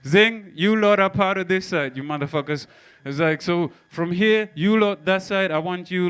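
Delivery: ordinary delivery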